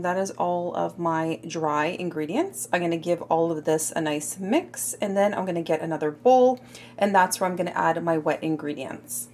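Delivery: normal talking voice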